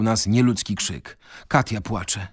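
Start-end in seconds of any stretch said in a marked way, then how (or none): none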